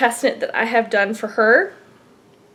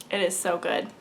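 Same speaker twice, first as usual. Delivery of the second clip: usual speaking voice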